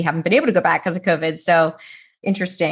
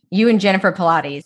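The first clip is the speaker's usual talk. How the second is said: ordinary talk, nothing marked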